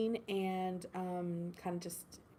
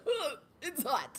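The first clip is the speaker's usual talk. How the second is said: high, slow groan of complaint, laughing; hot and complaining; 8 semitones higher, slower than usual. Retold or imitated. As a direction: whine about the heat, laughing. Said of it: "And they were like".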